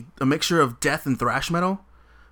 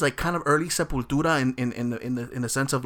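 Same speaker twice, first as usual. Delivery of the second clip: normal talking voice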